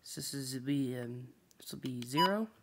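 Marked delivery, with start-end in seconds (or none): none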